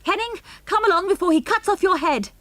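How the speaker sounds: high, loud, alarmed shout. A narrator's reading. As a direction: call him away from danger. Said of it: "shouted Mister Durlston".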